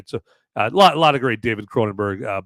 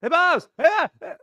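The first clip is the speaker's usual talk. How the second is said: much higher call down the hall. Pretend. Calling to the Bobs in the hallway, high call. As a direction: hail them from far away.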